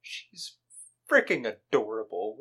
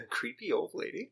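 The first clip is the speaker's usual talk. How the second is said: put-on creepy old lady; 7 semitones higher, 5 dB quieter than usual.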